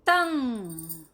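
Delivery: तंग said clearly with a firm nasal; teacher stressing the nasal in तंग; model pronunciation. Shown as a demonstration. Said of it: "आप उच्चारण पे ध्यान दीजिए".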